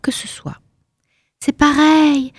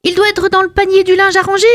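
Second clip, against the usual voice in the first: perky reply; 10 semitones higher, faster than usual. Acted. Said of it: "j'ai dit".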